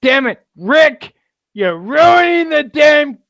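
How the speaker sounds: high, loud mock exasperation; Laurie. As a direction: imitate her complaining at full pitch. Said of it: "I love the fact that she thought".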